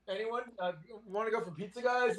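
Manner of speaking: lightly acting a clueless, carefree guard; mock nonchalance. Host mocks lax agents guarding an alien, mock nonchalance.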